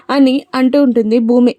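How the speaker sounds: ordinary delivery, nothing marked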